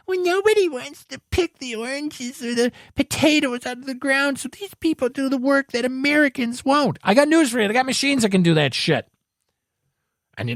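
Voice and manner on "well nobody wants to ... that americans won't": mocking bleeding hearts. Bleeding hearts defending migrant labor, high whiny mocking voice. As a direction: parody a preachy do-gooder. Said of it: "the bleeding hearts".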